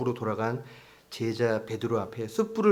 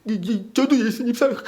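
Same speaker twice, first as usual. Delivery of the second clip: hiccuping, high and louder through tears; sobbing confession; 10 semitones higher, 5 dB louder than usual. Retold or imitated. Peter confessing love to Jesus while sobbing. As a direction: blubber the words out between sobs. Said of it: "서럽게 끄억끄억 우는 거".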